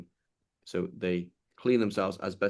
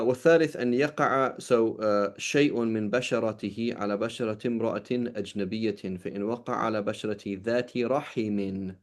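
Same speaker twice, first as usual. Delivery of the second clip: brisk, louder recitation of the classical Arabic text; reading Arabic text; 4 dB louder, faster than usual. Acted. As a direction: read the fiqh matn out at a quick clip. Reads the Arabic source text.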